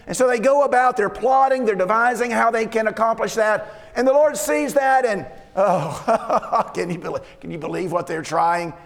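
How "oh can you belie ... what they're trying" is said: mocking laugh. God laughing at the nations' puny plotting, mocking scoff. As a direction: laugh it off like their plot is a joke. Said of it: "He laughs at them in derision".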